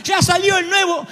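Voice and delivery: berrinche agudo y lloroso de niño; hijo lloriqueando. Retold or imitated. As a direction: llora como un hijo malcriado. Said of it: "y te dice". (grito agudo y lento con llanto de niño)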